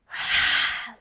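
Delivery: big loud sigh through the mouth; forceful sigh. Shown as a demonstration. Teacher exhaling with the class, loud forceful sigh. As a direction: push the breath out hard. Last breaths of the practice.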